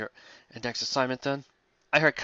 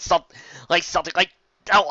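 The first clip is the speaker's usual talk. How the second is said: normal talking voice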